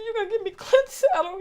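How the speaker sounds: high, laughing and teary disbelief; stunned disbelief. Retold or imitated. Telling how a friend gave her brother's saddle, stunned and teary. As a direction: ask it high, half laughing and half crying.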